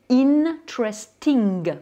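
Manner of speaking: English "interesting" with the first N held; clearly sounded N. Shown as a demonstration. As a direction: say "interesting" syllable by syllable, sounding each N. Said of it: "j'ai prononcé les N". (« interesting » en anglais, N bien prononcés)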